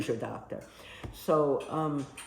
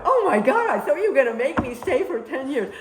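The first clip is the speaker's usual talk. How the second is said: high, loud burst of relief; relieved shock; 9 semitones higher, 9 dB louder than usual. Retold or imitated. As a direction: gush it out like a weight just lifted. Relieved shock at one-year offer.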